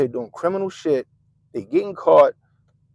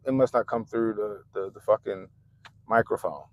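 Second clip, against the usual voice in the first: normal talking voice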